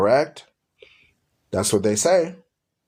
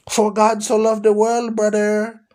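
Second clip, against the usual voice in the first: higher, mock preachy quoting voice; mocking a preacher dude; 6 semitones higher than usual. Mocking the Filipino dude who argues him down, higher and preachy. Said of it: "I'll get a Filipino dude come and argue me down".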